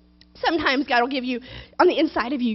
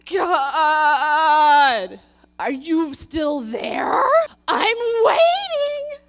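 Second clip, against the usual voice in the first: whiny plea; 2 semitones higher, 4 dB louder, much slower than usual. Acted. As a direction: whine at God, half crying. Sermon acts impatient believer.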